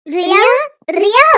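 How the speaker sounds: loud searching call, rising at the end. Acted. Searchers shout the missing girl's name, calling out.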